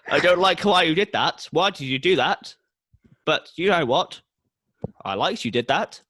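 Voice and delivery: loud, high puzzled god; loudly baffled, then approving. Acted. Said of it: "The voice of Hades rings".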